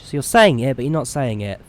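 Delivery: usual speaking voice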